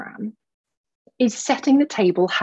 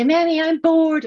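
put-on higher and louder, a child whining to mum; whiny kid; 7 semitones higher, 4 dB louder than usual. Mum imitates her kids complaining, a mock whiny child. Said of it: "my kids go".

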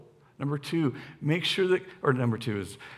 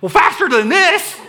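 high, loud exasperated yell with a laugh; mock shout; 12 semitones higher, 10 dB louder than usual. Instructor shouting at teen.

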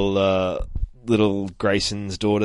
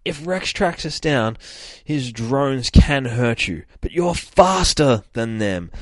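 half-held-back yell, slightly raised and drawn out; restrained yell; 5 semitones higher, 4 dB louder, slower than usual. Acted. Yelling to a companion with a child asleep nearby, held-back yell. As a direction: call it out without really yelling. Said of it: "I'm guessing she's yelling this out".

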